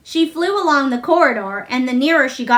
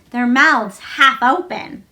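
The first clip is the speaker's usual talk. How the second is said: plain reading voice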